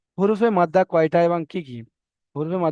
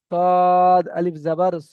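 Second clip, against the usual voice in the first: সদ আলিফ জাবার স spelled out very slowly and louder, each letter name drawn out; slow spelling out; 5 dB louder, much slower than usual. Shown as a demonstration. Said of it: "এখানে বানান করতে বলা হয়েছে".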